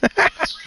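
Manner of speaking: laugh. Real, not acted. Dad laughs after spin.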